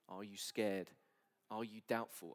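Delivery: ordinary talk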